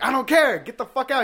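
high loud kick-out yell; mock furious shout. Cashier yelling at the customer to get out. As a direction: scream him out the door.

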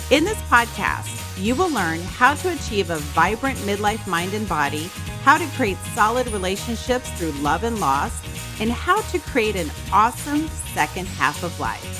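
upbeat host intro read; podcast intro. Acted. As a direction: read it like a show opener. Podcast intro read over music.

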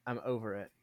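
understated, low-voiced retold resolve; subdued resolve. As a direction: quietly imitate someone insisting they're over it. Voicing Jane's earlier resolve.

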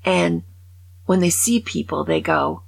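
ordinary delivery

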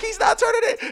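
very high, crying mock wail; high-pitched hammed-up distress; panicked wail. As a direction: imitate a panicking friend's squeal. Panicking friend quoted amid yelling.

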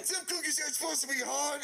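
high, indignant complaint; acted tirade. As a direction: imitate an outraged customer. Retold tirade at the cashier.

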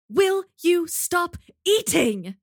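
prim know-it-all scolding; Hermione impression. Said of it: "Hermione Granger".

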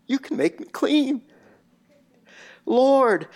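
a humble plea, repeated, fading away to a whisper on clean; a leper's face in the dirt, repeating his plea, fading to a whisper; repeated desperate plea. Acted. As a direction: beg again and again, dying down to a breath. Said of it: "he's repeating this".

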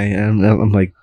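ordinary delivery

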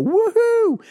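a high, loud gleeful whoop; excited whoop. Retold or imitated. Two summer boys cheering mowing day, loud whoop. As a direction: cheer like kids let out of school.

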